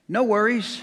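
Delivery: mock Aussie "No worries", drawn out and bright; Australian accent. Preacher imitating Australians he met, Australian accent. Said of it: "they talk funny in Australia".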